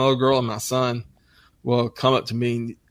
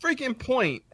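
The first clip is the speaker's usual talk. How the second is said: put-on higher, exasperated dad prodding his kid; mock impatient; 13 semitones higher, 6 dB quieter than usual. Dad urging his daughter to get to the point, higher and exasperated. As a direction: hurry her along, pitched up and fed up. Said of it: "I said".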